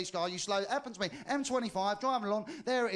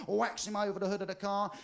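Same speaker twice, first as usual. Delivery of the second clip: ordinary delivery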